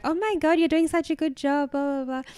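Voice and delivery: a hint of an excited proud mum; gushing mum.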